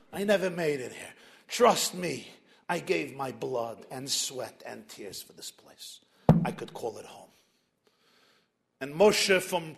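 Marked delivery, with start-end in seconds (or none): 0.1-7.3 put-on a tired old man, soft and low; weary old man. Old Moses voiced in a story.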